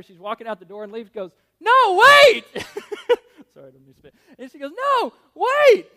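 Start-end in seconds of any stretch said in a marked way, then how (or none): 1.6-2.4 loud high cry to stop her; shouted call. Retold or imitated. Lee shouting after the doctor, loud desperate call. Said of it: "Like that". 2.5-3.5 laugh. Real, not acted. Laughing after own shout. 4.7-5.9 loud high cry to stop her; shouted call. Shown as a demonstration. Preacher re-showing Lee's shout, loud high call. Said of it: "Like that".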